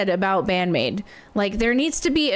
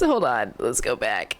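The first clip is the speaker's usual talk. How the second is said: ordinary talk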